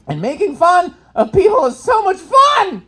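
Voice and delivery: high, loud, slow gleeful cry; gleeful shout. Real, not acted. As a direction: relish it out loud. Exclaiming with delight.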